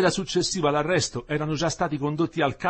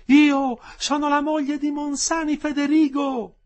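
high, slow, loud call; woman shouting out; 7 dB louder, slower than usual. A narrator's reading. Wife announcing herself to her jailed husband, shouting. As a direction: shout each name so it carries. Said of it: "gridò la stessa voce".

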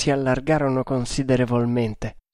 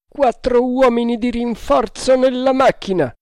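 high, carrying order; shouted command; 10 semitones higher, 4 dB louder than usual. A narrator's reading. Captain sending men to the engine, shouting. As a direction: call it out to the whole crew.

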